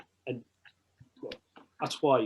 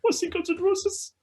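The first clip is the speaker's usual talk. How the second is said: high, whiny mock-sad wail; mock sad fan; 3 dB louder than usual. Fan hearing Guns N' Roses may cancel, mock whine. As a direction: whine it like a heartbroken kid. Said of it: "I'm panicking".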